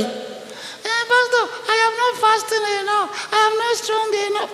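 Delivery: put-on high pleading girl's voice; whiny young girl. Young girl complaining to the pastor, high and whiny. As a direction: mimic a doubtful girl. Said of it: "Are you hearing me?".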